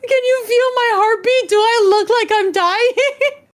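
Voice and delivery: very high, loud, cry-laughing plea; can barely get it out for laughing; laughing mock panic. Retelling the friend's plea, cry-laughing. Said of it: "He was like".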